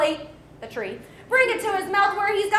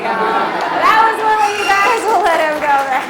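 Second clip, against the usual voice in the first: loud show-host wrap-up; big, loud send-off to a crowd; calling out; 2 semitones higher, 8 dB louder than usual. Real, not acted. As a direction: project over the applause. Calling out over applause to audience.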